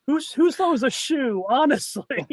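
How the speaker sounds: Austin Powers quote. Retold or imitated. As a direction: drawl it like a spy spoof. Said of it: "think of Austin Powers".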